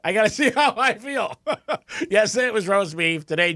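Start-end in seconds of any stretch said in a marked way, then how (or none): 0.0-1.4 high, teasing voice with a laugh; playful self-quote. Retold or imitated. To Franny about last night's text, high and teasing. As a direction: tease the friend, laughing. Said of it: "I'm like".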